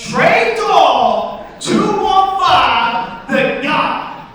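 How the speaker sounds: high, very slow acted shout for someone who missed it; emphatic repeat. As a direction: stretch every word out long and loud. Mugger repeats it after "Excuse me?.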